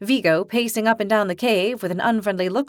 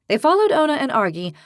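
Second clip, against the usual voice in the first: ordinary delivery, nothing marked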